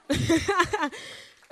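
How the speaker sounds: high, loud burst of laughter. Real, not acted. Laughs after thanking the audience.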